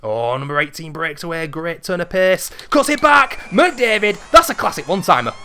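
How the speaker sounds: hockey commentator building to a goal call; excited play-by-play. Acted.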